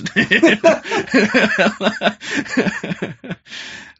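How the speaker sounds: loud, high burst of laughter; helpless laughing fit; long real laugh. Real, not acted. Laughing at his own banner joke, loud high laughter.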